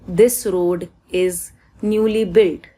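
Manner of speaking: this road is, drawn out word by word; very slow. Shown as a demonstration. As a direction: say this road is very slowly. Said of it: "रो ओ आ रहा है". (this road is, बहुत धीरे-धीरे)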